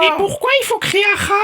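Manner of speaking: ordinary talk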